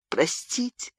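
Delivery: a quiet tearful простите; sobbing plea. A narrator's reading. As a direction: begin begging through tears, quietly. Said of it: "зарыдала она".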